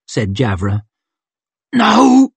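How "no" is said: loud, high strained grunt; urgent grunt. A narrator's reading. Trapped man corrects the thieves, grunting urgently. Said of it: "he grunted".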